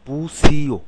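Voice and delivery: বুসিও said out loud for learners; loud model word. Shown as a demonstration. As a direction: call out বুসিও so the class hears it. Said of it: "তাহলে মু".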